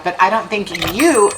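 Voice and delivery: usual speaking voice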